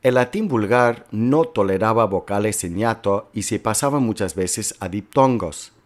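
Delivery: ordinary delivery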